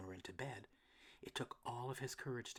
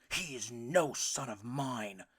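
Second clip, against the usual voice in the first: louder angry papa; bellowing father; 8 dB louder, slower than usual. A narrator's reading. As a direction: scorn the son's running. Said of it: "declared Papa".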